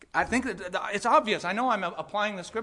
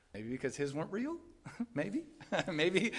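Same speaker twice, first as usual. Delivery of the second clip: soft chuckling; 7 dB quieter than usual. Real, not acted. Chuckling speculation about Simon.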